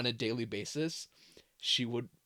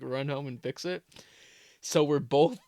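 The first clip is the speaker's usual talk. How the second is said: normal talking voice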